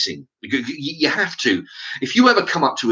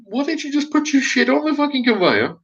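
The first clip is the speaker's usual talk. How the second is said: higher, incredulous grumble in his head; exasperated inner thought. Retold or imitated. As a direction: voice the annoyed thought, pitched up. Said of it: "And I'm thinking".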